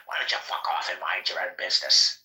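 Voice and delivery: low-pitched mock British woman; put-on British accent. Woman spraying Roundup told it's illegal, snapping back in mock British accent. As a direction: retell her brush-off in a British accent. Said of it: "She didn't say it with a British accent".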